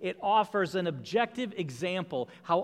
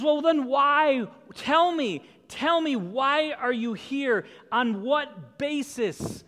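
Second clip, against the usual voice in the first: pressing angel supervisor; 7 semitones higher, 6 dB louder, slower than usual. Acted.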